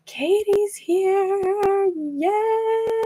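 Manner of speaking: high, drawn-out singsong cheer; excited welcome. Real, not acted. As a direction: squeal it slowly in delight at a friend arriving. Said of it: "Sorry, I get really excited".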